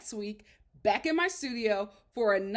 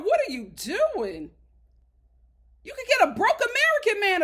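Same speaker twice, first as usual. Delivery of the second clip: higher, louder scolding rant; 7 semitones higher, 7 dB louder than usual. Real, not acted. Scolding Tiffany about her choice of man, loud and high. Ranting at Tiffany through screen.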